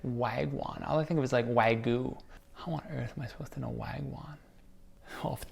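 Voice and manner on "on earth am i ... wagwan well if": whispering. Real, not acted. Thinking aloud, whispered.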